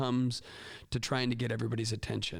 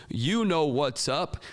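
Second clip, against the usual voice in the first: slow, louder chanted reply; class response; 2 semitones higher, 5 dB louder, much slower than usual. Shown as a demonstration. Students answering the teacher's call, loud drawn-out chant. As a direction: chant it back like the whole class. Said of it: "And they all go".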